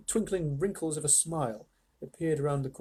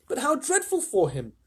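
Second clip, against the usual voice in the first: high, dismayed cry; Charlie; 10 semitones higher than usual. A narrator's reading. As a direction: cry out in pity, high-pitched. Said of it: "cried Charlie".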